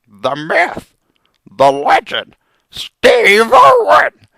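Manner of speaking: slow, loud, grand naming; epic announcement. Acted. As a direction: proclaim the legend's name. Reverent name of legend.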